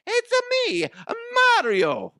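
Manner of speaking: very high, hammed-up Italian cartoon voice; Super Mario. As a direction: squeal it as a video game plumber. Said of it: "sounds like Super Mario Brothers".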